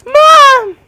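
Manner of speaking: Mom! shrieked very high and loud; squeaky yell. Shown as a demonstration. Scrawny ten-year-old yelling Mom! to his mom in the van, squeaky shriek. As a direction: squeak out Mom! like a scared little kid. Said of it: "'Cause I'm a little squeaker".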